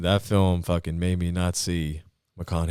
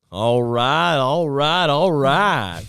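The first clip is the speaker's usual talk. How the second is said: loud, drawn-out, higher-pitched catchphrase; Matthew McConaughey; 7 semitones higher, 9 dB louder, much slower than usual. Acted. Comic does the line everybody knows, loud drawl. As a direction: belt out a famous actor's catchphrase impression. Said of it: "Oh, Matthew McConaughey".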